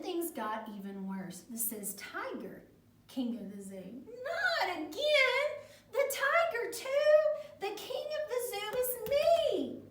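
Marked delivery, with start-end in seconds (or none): 4.1-7.6 very high, loud, drawn-out protest; hammed-up indignation, stretched slowly; exasperated outrage. A narrator's reading. Carlos protests yet another rival. 7.6-9.8 high, full-voiced storybook insistence; hammed-up outraged declaration; indignant boast. A narrator's reading. Carlos insists before he tires.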